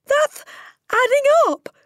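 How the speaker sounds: put-on very high, loud little boy; crying out. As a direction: cry it out as a panicked schoolboy. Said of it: "cried Wilfred".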